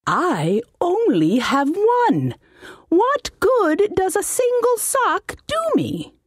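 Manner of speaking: high, put-on voice of a fussy old halfling mage; high-voiced wizard. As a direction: whine it like a pompous little wizard. Wizard complains about single sock.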